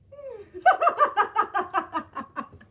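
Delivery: long, loud, high laughing fit; helpless high laughter; big laugh. Real, not acted. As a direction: burst out laughing at your own story. Laughs at own story.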